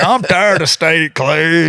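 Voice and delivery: imitating a fed-up eighty-year-old grandpa; lightly hammed-up grumpy grandpa. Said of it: "and he's like".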